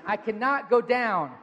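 with conviction. Shown as a demonstration. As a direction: declare it firmly, every word landing. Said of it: "say it with conviction".

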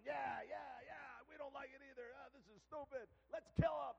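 high-pitched jeering crowd, bursting into a shout; hammed-up mob complaint; riled-up mob. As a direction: pile on like an angry mob. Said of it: "Then they jumped in".

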